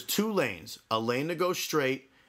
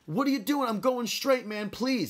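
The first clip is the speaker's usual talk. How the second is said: high-pitched, exasperated pleading with another driver; exasperated protest; 9 semitones higher than usual. Retold or imitated. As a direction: re-enact an indignant roadside protest. Acted roadside protest to honking driver.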